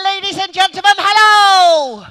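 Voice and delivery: high, very loud showman's hello; shouted stage greeting. Acted. Comedian greeting the crowd on entering, big shout. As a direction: holler to the whole hall, stretching the hello.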